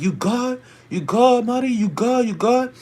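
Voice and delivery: high, urgent mimicked calls; group shouting, imitated. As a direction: call out repeatedly to a stranger. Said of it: "We all shouting like".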